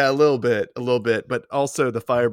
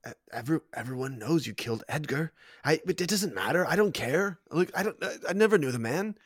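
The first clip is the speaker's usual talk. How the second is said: stammering, higher-pitched excuses; flustered suspect; 4 semitones higher than usual. Acted. Cornered character explains a killing to accuser, flustered. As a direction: trip over words while denying involvement.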